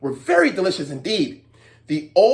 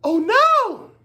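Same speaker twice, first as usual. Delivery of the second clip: startled cry; 13 semitones higher than usual. Acted. Old man slips into hole.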